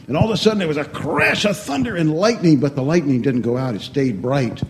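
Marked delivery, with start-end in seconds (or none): none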